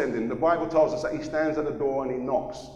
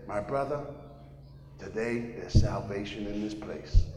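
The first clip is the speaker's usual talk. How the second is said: lower, quiet American preacher; American accent; 5 semitones lower, 6 dB quieter than usual. Retold or imitated. American preacher welcoming him to salvation, quiet American accent. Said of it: "there was another American".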